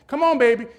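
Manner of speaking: high, loud motherly call; imitated mother calling. Mother walking the end zone to her son, imitated loud cheer. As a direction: call out like a doting mom.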